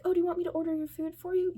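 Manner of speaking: high, loud, very fast gushing offer; excited woman. Retold or imitated. Tipsy stranger offering to order for a kid, excited. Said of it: "she's having such a great time".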